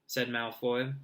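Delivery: ordinary delivery, nothing marked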